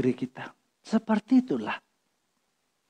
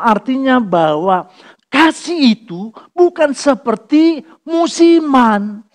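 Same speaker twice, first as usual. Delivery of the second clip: preaching at full force; 7 semitones higher, 6 dB louder than usual. Acted. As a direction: thunder it at the congregation.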